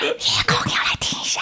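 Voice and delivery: eerie whispered ghost voice. Pretend. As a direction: breathe it like a haunting ghost. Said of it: "女鬼又来了".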